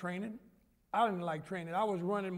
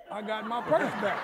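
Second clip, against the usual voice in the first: laughing; 2 semitones higher, 7 dB louder than usual. Retold or imitated. Mother's retold words, laughing.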